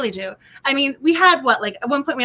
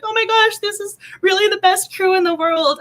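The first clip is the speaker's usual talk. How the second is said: higher, gushing mock delight; replaying her happy gush, brighter and higher; retold delight; 7 semitones higher, 3 dB louder than usual. Telling listeners how thrilled she felt, higher gushing delight.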